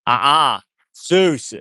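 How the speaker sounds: a loud, sharp uh-uh then a high call of the dog's name; sharp correction. Real, not acted. Owner sharply warning her dog Zeus off: loud correction.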